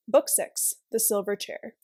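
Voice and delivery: usual speaking voice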